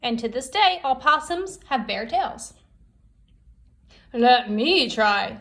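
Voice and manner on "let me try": slow, louder Buzzard voice; a proud buzzard stepping up; Buzzard's voice. Acted. Buzzard offers to fetch the fire, slow and proud. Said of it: "said Buzzard".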